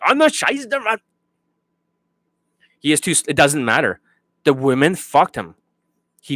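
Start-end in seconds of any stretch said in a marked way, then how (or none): 0.0-0.5 high, garbled rush of syllables. Acted. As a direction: gabble it out high, mocking fast talkers. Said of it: "syllables are not gonna be like".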